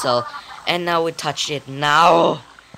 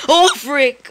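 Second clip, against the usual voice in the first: high, loud burst of alarm; real gamer shock, pitched up; startled outburst; 8 semitones higher, 5 dB louder than usual. Real, not acted. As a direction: blurt it out, caught off guard. Caught out by game enemy.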